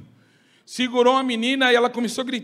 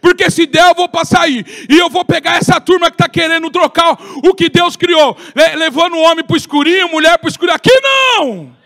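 higher, louder sing-song preaching over music; fiery preaching; 5 semitones higher, 8 dB louder than usual. Acted. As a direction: preach Paul's warning with rising fervour. Preaching Paul's words with music.

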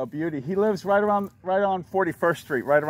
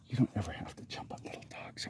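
whispered to a dog; 7 semitones lower, 14 dB quieter than usual. Real, not acted. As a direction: breathe it as a whisper. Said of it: "repeated as whisper".

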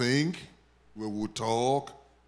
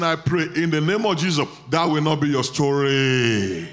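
loud, firm declaration; preaching at full force; 8 dB louder than usual. Acted. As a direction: declare it over them with force.

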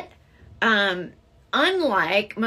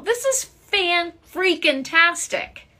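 high, loud, stretched-out delight; thrilled amazement; 9 semitones higher, 5 dB louder, much slower than usual. Real, not acted. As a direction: savour every syllable, thrilled and loud. Amazed at viewers from Argentina.

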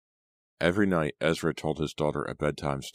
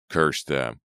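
angry, escalating shout; screamed; 7 dB louder, slower than usual. A narrator's reading. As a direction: hurl the curse out, building. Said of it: "Ezra screamed".

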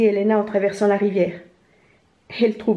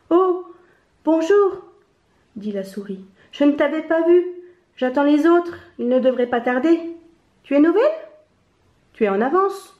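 lightly voiced mouse, higher pitch; a busy mouse greeting a newcomer, pitched up; chatty little mouse; 7 semitones higher, 3 dB louder than usual. A narrator's reading. (petite souris affairée, voix plus aiguë)

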